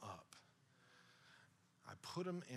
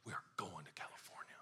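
hushed, insistent whisper-shout; quiet yelling. Retold or imitated. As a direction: yell it in a hard whisper. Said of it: "You ever yell quietly?".

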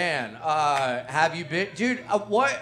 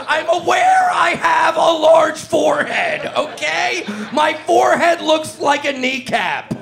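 loud, high, laughing mock outrage; frat bro; 8 semitones higher, 4 dB louder than usual. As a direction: bellow it like an offended party bro. Mock outrage at forehead remark.

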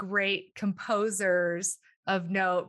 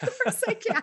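laughing reply. Retold or imitated. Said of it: "And so I was like".

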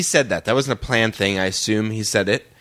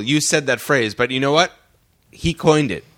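usual speaking voice

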